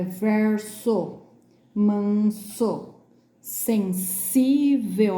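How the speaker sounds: slow verso, manso, sensível with crisp hissed S; true S sound. Shown as a demonstration. Teacher contrasting with Z words, syllabled verso and manso with clear S. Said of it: "com um som de S mesmo".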